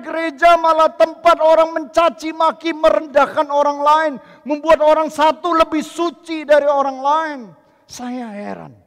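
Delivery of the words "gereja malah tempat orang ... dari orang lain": loud, high preaching to the congregation; fiery preaching. Acted. Pastor proclaiming God's great project to congregation, fiery preaching.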